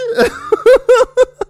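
very high, loud burst of laughter. Real, not acted. Laughing at his own shocked reaction, high laugh. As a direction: squeal with laughter.

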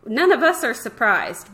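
usual speaking voice

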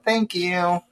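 high, mock-polite thanks; forced thank you. Mimicking the family ritual, a mock-polite thank you. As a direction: chirp a fake grateful thanks.

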